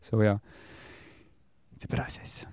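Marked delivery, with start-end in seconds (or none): 1.8-2.4 soft whispered self-reassurance; hushed, barely voiced; calming whisper. Real, not acted. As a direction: whisper it to settle yourself. Self-soothing after getting worked up.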